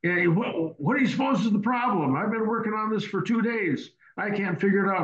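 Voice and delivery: a hint of the fed-up mechanic pleading for help; imitating the frustrated mechanic. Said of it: "He says".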